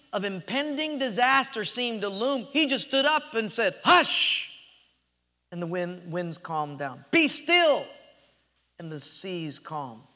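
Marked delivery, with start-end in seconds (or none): 3.8-4.7 loud, high one-word command; commanding shout. Retold or imitated. Preacher voices Jesus hushing the storm, commanding shout. 7.1-7.9 raised, high-pitched command; commanding shout. Retold or imitated. Preacher voices Jesus stilling the seas, commanding shout.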